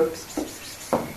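hushed, conspiratorial psst noises; very soft whispered hissing to a companion; whispering. Pretend. Player whispers secretly to Woot, whispering. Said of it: "I'm gonna be whispering to Woot and go".

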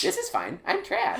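jumps way up high on trash; a squeaky little toy fork ending in a high squeal; Forky voice. Retold or imitated. Friend mimicking Forky, leaping high on trash. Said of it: "your Forky voice".

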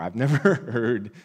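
rueful laugh. Real, not acted. Laughs mid-line recalling.

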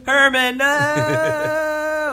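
high calling out to Herman. Pretend. To Herman on screen, a high mock call. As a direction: cry out to stop him.

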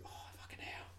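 whispered under the breath; horrified whisper. Retold or imitated. Friend voicing the soldier's dread in a whisper. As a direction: breathe it out in shock.

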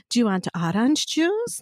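lightly imitated Slovak grandmother accent; grandmother's Zsa Zsa Gabor accent. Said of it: "more of the Zsa Zsa Gabor accent".

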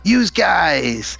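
mock mafioso New York accent; hammed-up Italian-American New York accent. Host showing off his New York accent, mafioso style. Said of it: "Italian mafioso with my New York accent".

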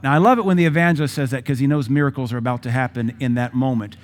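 usual speaking voice

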